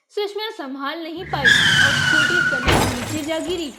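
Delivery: plain reading voice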